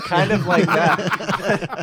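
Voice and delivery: slow, loud words through real laughter; can barely get it out for laughing. Real, not acted. Laughs mid-sentence.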